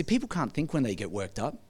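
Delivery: ordinary talk, nothing marked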